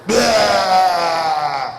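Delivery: loud retching blah with a cry in it; mock vomiting noise. A comic acting out throwing up milk, loud mock retch. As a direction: fake a baby throwing up, loud.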